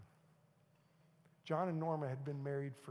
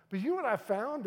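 higher and louder pulpit question; preaching question, bigger; 8 semitones higher, 10 dB louder than usual. Acted. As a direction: lift it higher the second time. Repeated rhetorical question.

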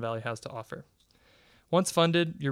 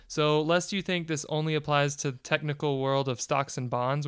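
normal talking voice

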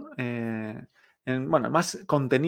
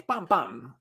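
"pam, pam" as two dry falling hits; two gunshots; 3 dB quieter than usual. Shown as a demonstration. Teacher likening two fourth tones to shots, "pam, pam" falling. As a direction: fire off each "pam" like a shot. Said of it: "como si fueran dos tiros".

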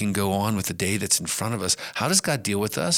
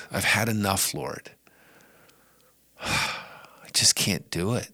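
weary sigh. Acted. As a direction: exhale the words like you have nothing left. Voicing a family member's weariness.

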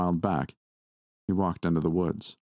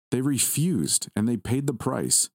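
put-on a higher, boyish voice, lightly done; male childish voice; 7 semitones higher, slower than usual. Boy to the grandmother, lightly boyish higher voice. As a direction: play a sly young boy, pitched up. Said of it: "a male, childish voice said".

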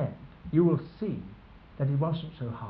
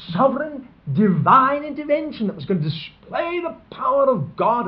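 higher and louder, building to a shout; fervent preaching; 7 semitones higher, 4 dB louder than usual. Acted. Preacher describing the hoped-for divine intervention, fervently. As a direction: preach it with soaring conviction.

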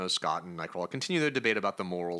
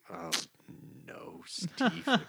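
mock dread; 7 semitones higher, 5 dB quieter, slower than usual. Real, not acted. Dread at the cult reveal.

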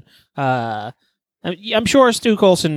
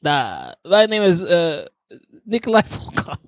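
mock Russian man. Introducing a Russian gymnast, in a mock accent. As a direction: announce himself like a Soviet athlete. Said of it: "I could do a guy fine".

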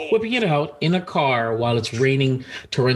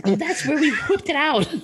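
high put-on granny voice; comic high elderly woman; old-lady caricature; 12 semitones higher than usual. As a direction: join in as a squeaky old woman. Joins the granny voice bit.

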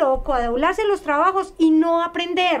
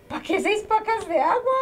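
higher, laughing through the question; amused disbelief; 6 semitones higher than usual. Retold or imitated. Retelling her surprise at the water gift, laughing. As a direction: scoff at the pile of water, half laughing. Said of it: "Y yo dije".

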